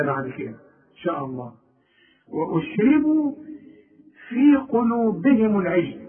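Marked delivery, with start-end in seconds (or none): 2.3-6.0 slow, higher-pitched Quran recitation; careful tajweed reading, drawn out; measured recitation. Shown as a demonstration. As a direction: recite slowly with lifted pitch and long vowels. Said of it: "كل راء في القرآن".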